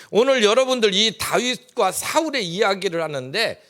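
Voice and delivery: 높고 크게 외치는 설교 톤; 회중을 향해 힘차게 선포하듯; 열정적인 설교. Acted. Preaches at full force. (성도들에게 믿음을 촉구하는 힘찬 설교)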